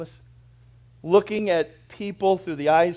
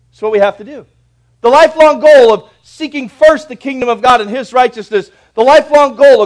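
loud, high, ringing sermon voice; thundering pulpit delivery; full-force preaching; 10 semitones higher, 17 dB louder than usual. Acted. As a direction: proclaim it to the whole church.

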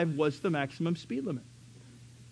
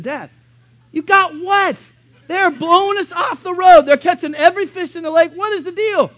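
much higher, louder pleading voice; exasperated kid; 11 semitones higher, 11 dB louder than usual. Retold or imitated. Son questioning dad, loud exasperated pleading. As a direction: complain like a frustrated boy. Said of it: "one day I said".

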